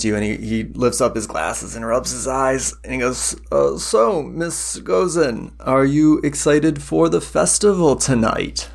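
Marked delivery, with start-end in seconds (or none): 3.5-8.7 kindly priest. Acted. Priest asks guest.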